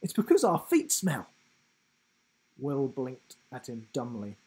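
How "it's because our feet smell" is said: high, louder, teasing and playful; goofy giraffe voice. A narrator's reading. Giraffe teasing Will about a joke, goofy and playful. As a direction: tease him like a silly big kid.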